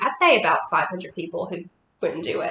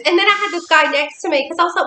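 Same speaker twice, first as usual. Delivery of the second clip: ordinary delivery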